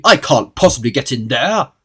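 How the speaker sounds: loud, irritated protest; snapping. A narrator's reading. Mister Uppity refusing the small hole, snappy protest. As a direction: object loudly and crossly. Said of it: "snapped Mister Uppity".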